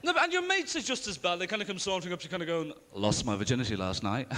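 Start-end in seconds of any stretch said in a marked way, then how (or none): 2.9-4.3 deep, cocky boast; deep, swaggering teenage brag; cocky mate. Retold or imitated. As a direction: boast it low like a cocky lad. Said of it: "Like, really cocky".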